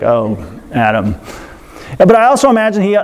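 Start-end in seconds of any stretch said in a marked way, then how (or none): 0.0-1.1 low, weary sigh of a fed-up God; exasperated God. Retold or imitated. Preacher voicing God face palming at Adam: low, exasperated. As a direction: drop low and groan out the name. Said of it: "I imagine God's face palming himself".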